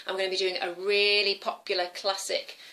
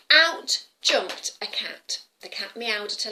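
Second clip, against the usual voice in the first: plain reading voice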